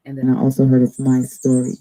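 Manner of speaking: ordinary delivery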